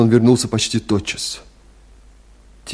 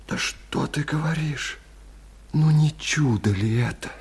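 whispered disbelief; stunned whisper; 4 semitones higher, 7 dB quieter than usual. Acted. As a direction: whisper in astonishment. Абай stunned by news of double. (изумлённым шёпотом)

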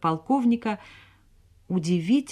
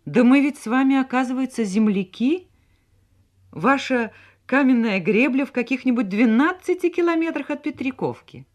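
high-pitched, agitated discovery; excited; 8 semitones higher, 4 dB louder than usual. A narrator's reading. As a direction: share it in a rush of excitement. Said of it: "воскликнула она взволнованно". (взволнованно, высоким голосом)

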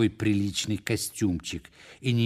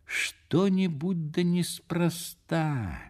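suspicious inner thought; 8 semitones higher, much slower than usual. A narrator's reading. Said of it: "сообразил он мысленно".

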